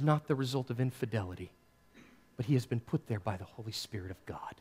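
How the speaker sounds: soft, hushed plea that sinks to a whisper; very quiet. Retold or imitated. Mary quietly telling Joseph she is faithful, hushed. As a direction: plead gently, barely above a whisper. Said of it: "very quietly".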